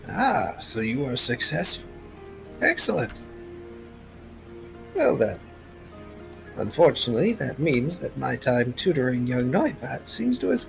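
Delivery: lightly voiced wise old mentor; a calm old teacher speaking; old man voice.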